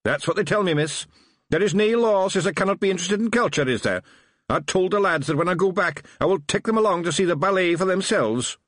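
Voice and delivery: high, lively Scottish Feegle; chirpy little Scots policeman. A narrator's reading. Feegle answering Tiffany.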